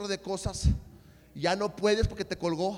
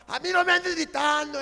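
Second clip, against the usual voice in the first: higher, louder heated protest; retold angry yelling; 7 semitones higher, 6 dB louder than usual. Retelling a food quarrel with his brother, heated yelling. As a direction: protest back in a re-enacted quarrel. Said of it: "estaba yo gritándole".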